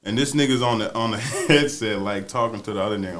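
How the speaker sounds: normal talking voice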